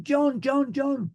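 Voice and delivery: high-pitched urgent calling; retold alarm. Student pilot calling the instructor mid-climb, retold alarm. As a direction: call his name in mock panic.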